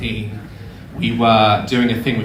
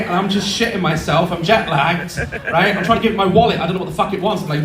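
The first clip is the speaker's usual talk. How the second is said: normal talking voice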